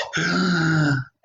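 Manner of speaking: high gasping wind-up; sharp intake of breath. Acted. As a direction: suck in air before blowing. Wolf winds up to blow.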